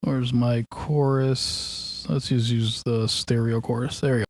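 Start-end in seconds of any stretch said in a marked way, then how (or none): none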